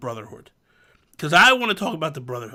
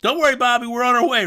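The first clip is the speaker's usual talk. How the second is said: a bit higher and louder, a hint of heroic rescue; lightly voiced reassuring teammates; 6 semitones higher, 4 dB louder, faster than usual. Teammates answering Bobby's call for help, mild heroic voice. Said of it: "they're like".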